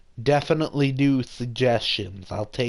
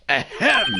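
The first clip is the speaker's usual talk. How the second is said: over-the-top throat clearing; 7 semitones higher than usual. Acted. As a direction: clear the throat pointedly for attention. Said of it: "the most over-the-top clearing of the throat".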